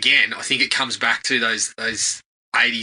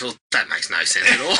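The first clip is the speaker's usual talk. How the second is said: dad retort; 7 semitones lower than usual. Retold or imitated. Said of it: "And he goes".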